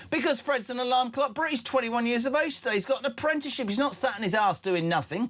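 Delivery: big proud shout-out; enthusiastic shout-out. Real, not acted. Host cheering a listener's son on his birthday, excited.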